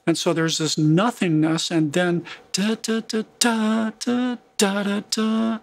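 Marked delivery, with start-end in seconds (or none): 1.7-5.6 da da higher, slow and even; higher, sung-through rhythm; scatted accents. Shown as a demonstration. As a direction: sing out where the accents land. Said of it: "Look where the second emphasized note is happening".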